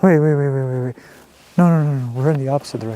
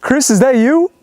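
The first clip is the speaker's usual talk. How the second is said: high nervous call; uneasy shout; 10 semitones higher, 4 dB louder than usual. Real, not acted. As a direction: call into the dark for a friend. Calling into the dark woods.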